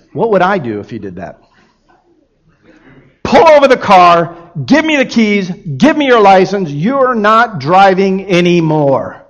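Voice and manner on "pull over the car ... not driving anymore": loud, stern father's order; stern rebuke. Retold or imitated. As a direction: bark the orders at a reckless son. Father rebuking son who ran light.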